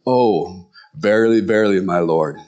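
low, solemn mock reverence; pious disciples. Imagined disciples answering Jesus, mock-pious. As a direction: intone it low, like a stock holy figure. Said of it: "they would say".

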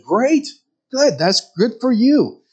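sarcastic well-done; sarcastic praise. Acted.

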